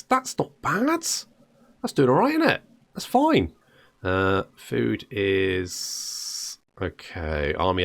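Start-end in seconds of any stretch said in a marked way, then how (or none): 0.0-3.5 pleasantly surprised. Real, not acted. Pleased by army count.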